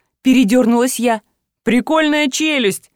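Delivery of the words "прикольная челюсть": delighted. A narrator's reading. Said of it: "восхитился Филипп".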